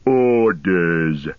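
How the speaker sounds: orc chief's growled command; deep, drawn-out orc growl. A narrator's reading. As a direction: growl it out long and heavy. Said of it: "said a third voice in a deep growl".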